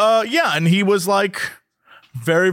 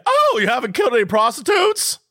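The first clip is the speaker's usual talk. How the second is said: mock shocked cohost; 6 semitones higher than usual. Cohost playing Robin reacting to the caller, hammed-up shock. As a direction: blurt it in hammed-up disbelief. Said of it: "Robin would have said".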